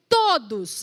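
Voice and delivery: todos said loud and falling; stressed todos. Shown as a demonstration. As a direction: hit todos for the crowd to repeat. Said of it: "repete comigo".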